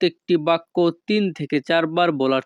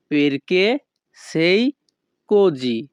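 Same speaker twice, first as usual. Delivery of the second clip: "Perché", "sei", "così" said apart with pauses; word by word; much slower than usual. Shown as a demonstration. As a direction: separate each word clearly.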